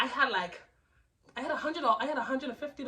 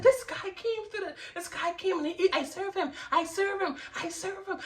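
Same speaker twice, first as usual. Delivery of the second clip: high, urgent identification; acted high-pitched indignation; retold agitated recognition; 8 semitones higher than usual. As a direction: blurt it out as if spotting the culprit. Recognising the thief on camera.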